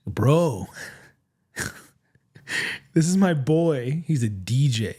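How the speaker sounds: slow, laughing take on a friend's greeting; Junior voice. Retold or imitated. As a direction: drawl it out like Junior introducing his boy. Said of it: "I can't do my Junior voice yet".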